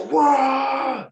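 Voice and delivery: sudden high loud "Wah!" of fans celebrating a goal; crowd cheer. Retold or imitated. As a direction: burst out like a football crowd when someone scores. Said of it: "they just go".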